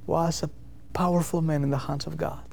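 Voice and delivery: ordinary talk, nothing marked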